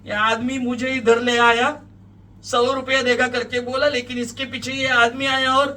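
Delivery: high, loud complaint; accusing young woman. A narrator's reading. Young woman accuses man before police, high and loud. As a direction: tell the police it was all his doing.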